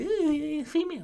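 put-on high, breathy-free swooping ooh of boys; high mock swoon; mock horny ooh. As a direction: coo it up high like giddy teens.

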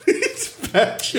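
high, slow words squeezed through laughter; giggling punchline; laughing. Real, not acted. Laughing after joke.

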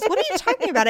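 high-pitched imitation of his confusion; partner's baffled question. Retelling the crayon argument, him baffled. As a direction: play him puzzled, pitched well up. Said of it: "He goes".

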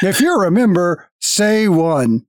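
slow, stiff robotic greeter voice; guild automaton. Acted. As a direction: speak like a polite machine at a desk.

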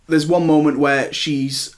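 usual speaking voice

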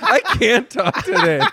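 laughing, high and giddy. Real, not acted. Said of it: "I'm fucking wired today".

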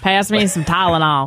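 cheerful country twang. Acted. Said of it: "He is a country girl".

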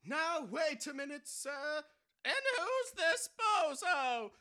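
loud, high robber yell; frantic shouting hostage taker. Acted. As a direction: bark it like a stick-up man. Robber sees guest.

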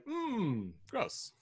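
high, drawn-out mock disgust; disgusted viewer groans at a gross scene. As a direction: recoil at the screen in a high voice. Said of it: "anybody else was like".

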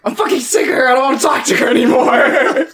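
high-pitched, laughing mock outburst; exasperated venting. Venting to a friend on the phone about the ex, exasperated.

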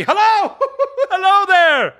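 very high, loud holler through laughter; laughing whoop. Acted. Scrooge in transports of joy, laughing high whoop.

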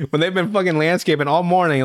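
normal talking voice

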